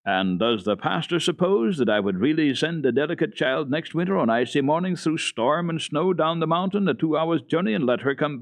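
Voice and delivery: indignant defiance building toward a threat; losing his calm. A narrator's reading. Old man defending the child against the pastor, indignant. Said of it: "no longer so perfectly calm in his mind".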